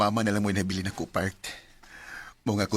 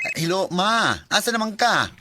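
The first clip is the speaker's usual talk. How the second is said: eager phone greeting; 9 semitones higher, 5 dB louder than usual. Acted. Son answers mother's phone call.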